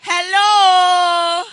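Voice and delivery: loud hello to the room; calling out. Real, not acted. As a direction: shout it out over the music. MC calls out to the crowd.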